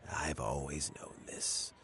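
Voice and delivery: soft, resigned whisper; sad whisper. Acted. Old man admitting a sad truth to himself, whispered. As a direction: breathe it out, barely voiced.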